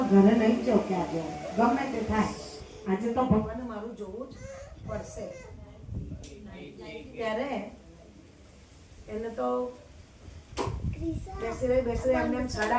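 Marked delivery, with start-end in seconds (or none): none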